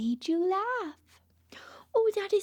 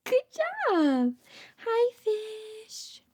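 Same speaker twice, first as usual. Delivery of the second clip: ordinary delivery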